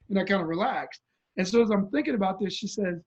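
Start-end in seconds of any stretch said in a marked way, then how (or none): none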